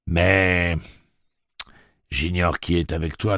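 lightly voiced droning gatekeeper; drawling voice. A narrator's reading. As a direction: stretch it out, coolly threatening. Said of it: "la voix traînante".

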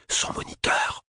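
fully whispered classroom aside. A narrator's reading. Classmate passes the news to the others, whispering. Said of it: "chuchota-t-elle à l'intention des autres".

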